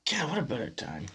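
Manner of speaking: whispered under the breath; a wry, barely voiced whisper. Real, not acted. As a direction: hush it like a dark joke. Dark aside about nine eleven.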